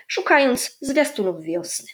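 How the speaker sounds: usual speaking voice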